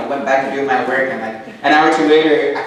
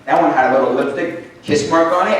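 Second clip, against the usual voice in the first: ordinary talk, nothing marked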